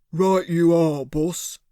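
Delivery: slow-talking thug, a lightly put-on voice; a hint of a dim heavy; henchman Cyril. A narrator's reading. Cyril answers his boss, a lightly voiced dim thug.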